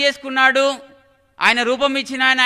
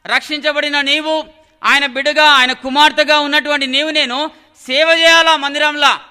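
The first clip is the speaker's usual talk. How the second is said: loud preaching, building in volume; forceful exhortation; 4 semitones higher, 7 dB louder than usual. Real, not acted. Preacher urging the saved to serve in church, forceful exhortation. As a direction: urge the congregation, louder with each phrase.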